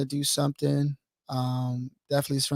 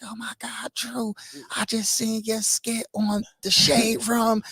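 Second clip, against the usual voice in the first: higher, slow gushing fan; excited fan voice; 7 semitones higher, slower than usual. Retold or imitated. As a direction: gush like a starstruck fan.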